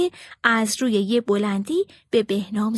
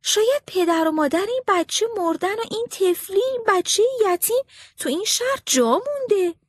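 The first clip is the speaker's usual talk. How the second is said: higher, softened voice of a soldier; pitying soldier; 8 semitones higher than usual. A narrator's reading. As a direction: feel sorry for the little orphan. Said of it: "یکی از اون دو نفر گفت".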